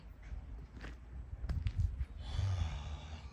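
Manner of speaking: long unvoiced despairing exhale; real weary sigh; long grieving sigh. Real, not acted. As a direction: breathe out the loss slowly. Grief over ruined trucks.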